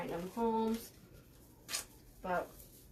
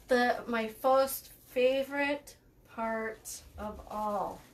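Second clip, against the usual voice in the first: slow, drawn-out mock enthusiasm; thick sarcasm; much slower than usual. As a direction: lay the sarcasm on thick, word by word. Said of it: "Did you hear the sarcasm".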